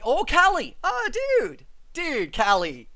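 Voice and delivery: high, loud burst of excitement; excited. Real, not acted. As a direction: exclaim with delighted recognition, pitch leaping up. Delighted at viewer being Californian.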